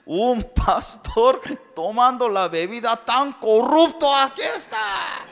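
high, slow, loud scolding wife; wife shouting at her flushed husband. Retold or imitated. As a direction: scold the pastor, high and shocked. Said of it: "Mi esposa me grita".

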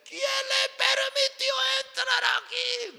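very high, drawn-out mock furious yell; shouting. Husband yelling at pastor who walked in, angry shout. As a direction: shout it as an outraged husband, stretched out. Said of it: "Otra vez me gritaba".

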